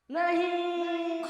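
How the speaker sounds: hammed-up, stretched cry of no; melodramatic shock. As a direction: wail it in horrified disbelief. Dramatic shock at the confession.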